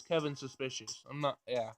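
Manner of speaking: ordinary delivery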